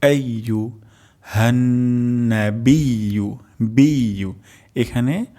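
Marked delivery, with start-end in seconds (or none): 1.2-4.3 হান্নাবিয়ু drawn out very slowly with a held doubled n; slow stretched recitation. Shown as a demonstration. Teacher models the next word very slowly. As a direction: stretch হান্নাবিয়ু, then repeat বিয়ু. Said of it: "তারপর শব্দটি হলো আইয়ু".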